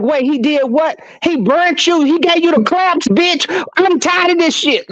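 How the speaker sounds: high, worked-up mock indignation; pretending outrage so the man hears; fake outrage. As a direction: ham up the shocked friend act. Staged outrage on phone.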